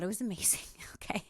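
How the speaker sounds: breathy whisper of delight. Real, not acted. Confiding to viewers about the massage, whispered. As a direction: hush it like a secret pleasure. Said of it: "unvoiced whisper".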